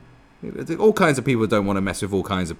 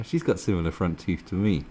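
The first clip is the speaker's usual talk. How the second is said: ordinary talk, nothing marked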